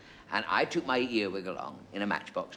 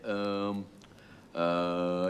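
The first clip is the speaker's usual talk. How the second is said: producer impression; 6 semitones lower, 5 dB quieter than usual. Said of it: "a very lifelike impersonation of our new producer".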